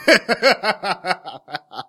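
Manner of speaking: loud, high cackling laugh; real laughing fit. Real, not acted. Co-host recalling another time on stage, loud laughing. Cracks up before telling embarrassing story.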